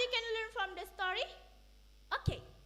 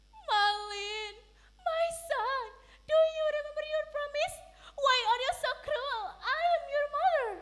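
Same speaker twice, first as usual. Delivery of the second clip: higher, fast tearful plea; begging sadly; 8 semitones higher, 5 dB louder than usual. Acted. Mother begging her cruel son, tearful plea. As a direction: plead through tears. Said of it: "begged sadly".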